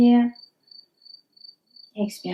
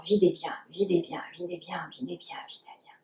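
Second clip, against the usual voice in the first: repeated cue sinking from soft voice into a breathy whisper; fading to a whisper; 4 semitones lower, 10 dB quieter, faster than usual. Real, not acted. Guiding the class through a long exhale, fading to a whisper. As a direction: let each repeat drain away until only breath is left.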